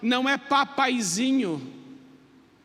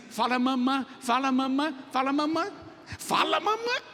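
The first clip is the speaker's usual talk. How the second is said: coaxing mother; 3 semitones higher than usual. Retold or imitated. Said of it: "E a mãe fica assim".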